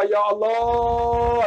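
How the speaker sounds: long drawn-out lament; retold father's wail. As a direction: stretch it out like a crying father. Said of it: "Teriak dia". (ratapan ayah yang meleret)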